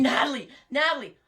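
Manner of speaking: calling her name loud and high, half-acted; mock alarmed shout; retold yelling. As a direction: echo the husband's panicked yell. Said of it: "I hear him screaming".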